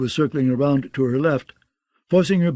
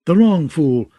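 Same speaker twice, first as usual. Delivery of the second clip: quick, louder outburst; blurted; 3 semitones higher, 5 dB louder than usual. A narrator's reading. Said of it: "blurted Brienne".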